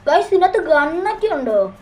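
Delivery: high, loud eager question; excited. Real, not acted. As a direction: ask it all fired up. Finding a revolver part. (ആവേശത്തോടെ)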